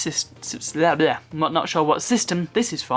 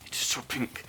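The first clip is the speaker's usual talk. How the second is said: whisper; 4 semitones lower, 12 dB quieter than usual. Real, not acted. Echoes own remark.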